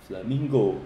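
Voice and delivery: ordinary talk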